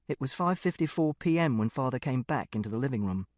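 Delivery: ordinary delivery, nothing marked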